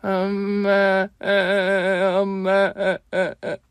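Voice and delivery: put-on high, drawn-out child wail; boy crying in front of mother's photo; child sobbing. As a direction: wail for mom like a small boy. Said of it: "또 다른 울음소리가 들리는 겁니다".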